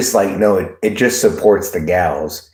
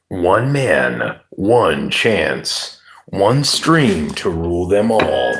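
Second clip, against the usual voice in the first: slow, dramatic movie-trailer voiceover; movie guy voice. Pretend. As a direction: intone it like an epic film trailer. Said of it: "It's the movie guy".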